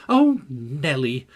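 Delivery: loud, drawn-out petulant complaint. A narrator's reading. Catherine complains to her maid Nelly, petulantly. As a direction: whine it out slowly, put out. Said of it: "she added petulantly".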